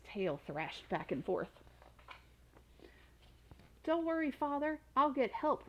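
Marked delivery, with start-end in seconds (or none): 3.8-5.6 higher called reassurance over the shoulder; calling out. A narrator's reading. Son calls to his dragon father, higher and calling. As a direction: call back while running. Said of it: "Radius called over his shoulder".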